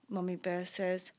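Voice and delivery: ordinary delivery, nothing marked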